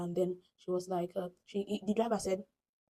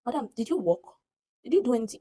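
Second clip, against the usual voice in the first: higher, louder rebuking questions; indignant challenge; 5 semitones higher, 6 dB louder than usual. Retold or imitated. Speaker scolds fellow passenger over reward, indignant. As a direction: challenge her claim to a reward.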